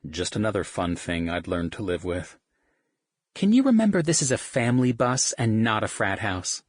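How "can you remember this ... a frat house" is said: a higher, louder scolding woman's voice; tired mom reining in the boys; mother scolding the band on the bus; exasperated mother. Acted. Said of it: "she asked".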